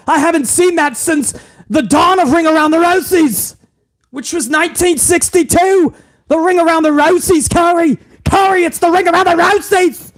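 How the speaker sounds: high, shouted hammed-up play-by-play; mock commentator. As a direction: oversell the call like a cheesy announcer. Mock play-by-play calling to Corey.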